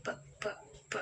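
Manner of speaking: p as a short puffed "peh"; quick isolated "p" bursts; voiceless sound demo. Shown as a demonstration. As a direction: sound out p three times, spaced. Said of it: "di situ voiceless ya".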